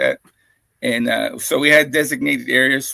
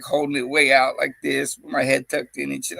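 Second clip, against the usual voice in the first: ordinary talk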